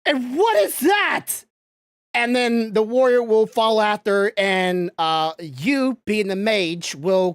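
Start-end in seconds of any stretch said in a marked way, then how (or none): 0.1-1.3 panicked Kane. Acted.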